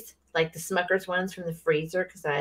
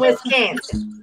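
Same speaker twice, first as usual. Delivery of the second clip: drawn-out, louder mock Wisconsin accent; higher, slow Wisconsin twang; 5 semitones higher, 5 dB louder, much slower than usual. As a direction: stretch it out in a hammed-up Wisconsin accent. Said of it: "you gotta do accents".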